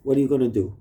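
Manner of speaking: usual speaking voice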